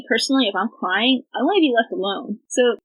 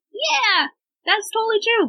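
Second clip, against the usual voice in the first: eager agreement; 11 semitones higher than usual. Retold or imitated. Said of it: "you're like".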